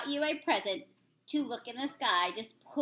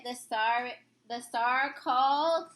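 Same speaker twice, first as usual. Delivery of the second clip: plain reading voice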